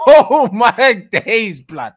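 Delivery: slow, loud laugh through oh my days. Real, not acted. Dreaming of free signings, slow laughing. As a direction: stretch it out laughing. Thrilled at transfer idea.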